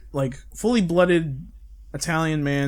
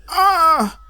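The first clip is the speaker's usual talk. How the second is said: high loud hammed-up cry of pain; pained scream; 8 dB louder than usual. Hero on the ground after the beating, a mock pained scream. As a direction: yelp like you've been beaten.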